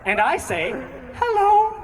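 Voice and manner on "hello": much higher, louder cheery greeting; bright high hello. Retold or imitated. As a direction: chirp a friendly hello at the window. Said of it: "as you do when you start a conversation".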